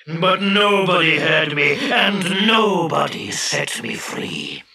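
slow genie voice building to a threat; embittered genie. Acted. Genie recounts his vow to kill, menacing. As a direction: brood slowly, then swell with vengeance.

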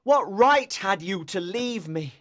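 impassioned accusing lover; tormented outpouring, stressing the key words; anguished cry. A narrator's reading. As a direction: hurl the reproach with heavy emphasis. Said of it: "he cried".

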